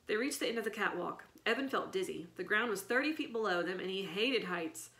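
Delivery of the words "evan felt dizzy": ordinary delivery, nothing marked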